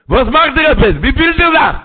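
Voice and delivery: high, loud and astonished, in another voice; loud exclaiming question. Acted. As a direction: call out the question in disbelief.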